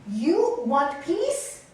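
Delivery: very slow, loud, rising question; guru's challenge. Retold or imitated. Guru challenges Nalini about peace, loud and deliberate. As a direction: imitate a guru stressing each word.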